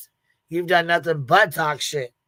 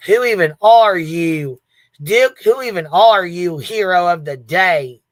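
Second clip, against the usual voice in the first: loud, slow mock-scolding of the dog; drawn-out playful ribbing; teasing the dog; 2 semitones higher, 9 dB louder, slower than usual. Real, not acted.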